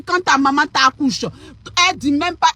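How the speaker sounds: normal talking voice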